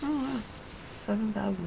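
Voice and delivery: usual speaking voice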